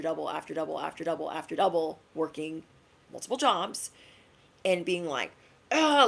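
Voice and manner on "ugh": loud, high frustrated groan; sharp exasperated ugh; angry ugh. Retold or imitated. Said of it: "like, angry".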